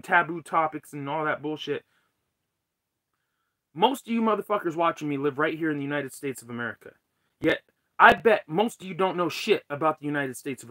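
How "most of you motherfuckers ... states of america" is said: usual speaking voice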